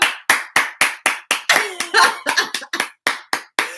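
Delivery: breathy high giggle; high laugh. Real, not acted. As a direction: let out a high airy laugh. Laughs after own pickup line.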